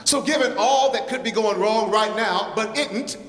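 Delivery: rhythmic, rhyming poem performance; lively spoken-word recital for the congregation. Acted. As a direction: lean into the rhymes like a slam poet.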